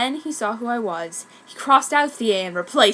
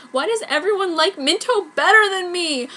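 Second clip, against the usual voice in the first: higher, louder mock wail; hammed-up sob; 8 semitones higher, 7 dB louder than usual. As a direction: overplay the whiny cry. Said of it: "I sobbed".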